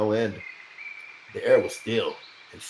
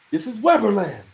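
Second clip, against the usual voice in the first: high, loud stubborn claim; defiant retort; 13 semitones higher, 7 dB louder than usual. A narrator's reading. Old digger defying the knight, high and loud. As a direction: stand your ground and say it.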